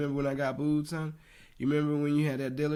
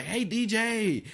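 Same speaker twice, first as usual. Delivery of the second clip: mock hype call; 10 semitones higher, much slower than usual. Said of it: "he was like".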